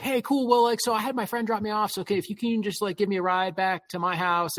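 high, fast, breezy mock voice; mocking the date. Date asking for a ride home, high breezy mock voice. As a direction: toss off the favour like it's nothing.

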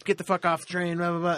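mock angry yell. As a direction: sneer it like a rude kid kicking her out. Kids hassling woman on train.

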